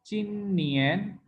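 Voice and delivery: slow चिन नियन, नियन climbing to the end; level then rising tone. Shown as a demonstration. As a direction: let नियन rise all the way. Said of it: "पहले जो है कम रहेगी".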